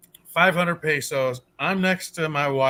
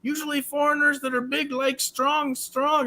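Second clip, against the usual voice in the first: much higher, softer put-on voice of a small old masseuse; imitating apologetic masseuse; 9 semitones higher, 4 dB quieter than usual. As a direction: squeak out apologies to the big foreigner. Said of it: "She's like".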